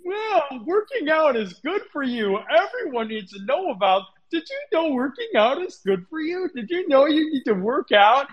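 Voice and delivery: high, hammed-up preachy fitness fan; mocking gym-bro voice. Gym fans gushing about working out, in a high mocking voice. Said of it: "they're like".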